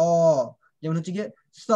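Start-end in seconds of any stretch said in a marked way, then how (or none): 0.1-0.1 অ pressed and held long; vowel with pressure. Shown as a demonstration. Teacher demonstrates the third vowel, long pressed অ. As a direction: put pressure on অ and stretch it. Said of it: "মানে pressure হবে".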